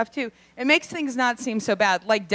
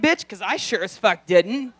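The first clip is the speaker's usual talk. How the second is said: ordinary talk, nothing marked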